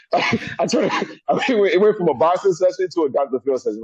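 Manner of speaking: high, laughing through the words. Real, not acted. Telling the interviewer the joke, laughing high. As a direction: talk while cracking up. Laughing at his own story.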